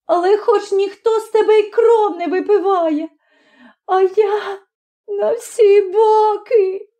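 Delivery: high, wailing self-pity; tearful lament, pitched very high; sobbing. A narrator's reading. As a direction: wail out the grievance. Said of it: "схлипуючи".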